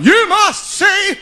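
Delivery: slow booming freak-show barker cry; sideshow barker yell. Retold or imitated. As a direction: bellow it slowly like a fair barker pulling in a crowd. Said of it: "he was just yelling at people".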